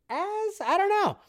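very high, squeaky "as, I don't know"; playful high-pitched shrug; feigned doubt. Acted. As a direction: shoot up into falsetto uncertainty. Feigned doubt about his wording.

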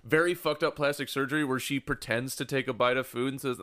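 ordinary talk

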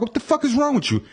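high-pitched retold outburst; fed-up confrontation. Walker retelling confronting the cabbie, high-pitched exasperation. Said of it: "I finally get tired of this".

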